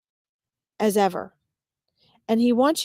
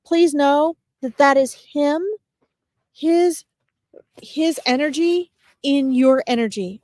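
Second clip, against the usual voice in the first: tearing up; 5 semitones higher than usual. Real, not acted. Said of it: "This is making me tear up".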